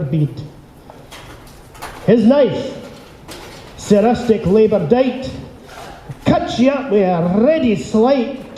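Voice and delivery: put-on Burns recited in a lightly Scottish accent; theatrical Scottish-accented reading of the haggis poem; Scottish accent poem recital. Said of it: "get used to the Scottish accent".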